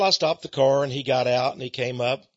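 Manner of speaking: ordinary talk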